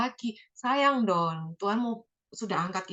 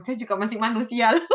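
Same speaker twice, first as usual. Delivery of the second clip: louder, with a catch of tears; a crying admission of weakness; tearful; 2 semitones higher, 6 dB louder than usual. Real, not acted. Admitting to friends she is only human, tearfully. Maklum ya teman-teman.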